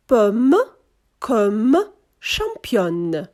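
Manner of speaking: Pomme, comme, championne with full final M or N, no nasal vowel; spaced model words Pomme, comme, championne, consonant endings sounded; O shaded toward E. Shown as a demonstration. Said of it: "Вона перетворюється більше схоже на звук Е".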